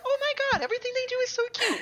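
very high, fast fawning gush. Retold or imitated. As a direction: coo it like over a puppy.

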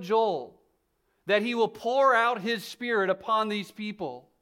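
projected, insistent sermon voice; emphatic preaching. Acted. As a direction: declare it to the whole congregation. Preaches Peter's answer with force.

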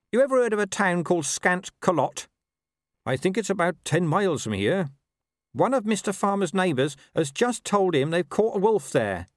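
high-pitched dog passing on news; urgent talking dog. Acted. Gaspode reports overheard news.

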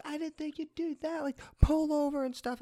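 shrill imitation of the instructor; teacher scolding. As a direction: squeak out her orders in a high fake voice. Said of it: "She's like".

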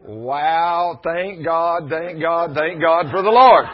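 high, loud exultant shout; real rejoicing shout; joyful praise. Real, not acted. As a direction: cry out thanks to God.

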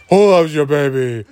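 slow, smooth ad pitch; smooth pitchman. Pretend. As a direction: croon the slogan like a pitchman. Pitchman slogan parody.

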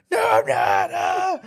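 loud protest breaking into a high yell; mock screaming child. Kid denies being bad to mom, imitated screamed protest. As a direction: shout it like a kid being told on. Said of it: "And he screamed".

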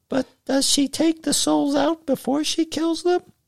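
put-on very high little-boy voice; squeak. A narrator's reading. Little brother asks about the old woman taking souls, squeaking. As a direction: squeak it like a small kid wanting to know. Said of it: "Dougie squeaked".

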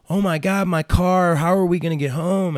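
high, loud mock wail; imitated distressed girl. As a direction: act out a child panicking about getting home. Retold girl upset after the crash.

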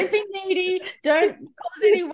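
high, playful inner-critic voice; high-pitched mock rules to yourself; mock self-instruction. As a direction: recite your own silly rules, amused. Voicing inner achiever's rules, laughing.

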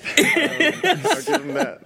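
high laughing concession. Real, not acted. Cohost laughs, crediting the photographer. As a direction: grant it through laughter.

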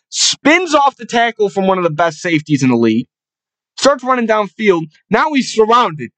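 ordinary delivery

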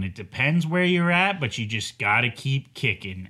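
normal talking voice

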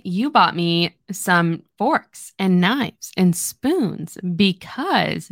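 slow, item-by-item teasing. Acted. He teases her about the cutlery she bought, teasing. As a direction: draw out the list to tease her. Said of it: "teasing her".

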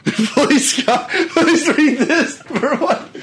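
high squeal of laughter; laughing. Real, not acted. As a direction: beg through a laugh. Cracking up at the reading.

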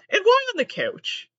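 high, loud incredulous question; shocked and high-pitched; incredulous why. Real, not acted. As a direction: ask it in high disbelief.

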